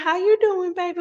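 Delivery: put-on high, sweet elderly auntie greeting; a fond imitation of a hundred-and-five-year-old aunt, high. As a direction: lift the voice up into a frail old auntie. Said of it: "She'll be like".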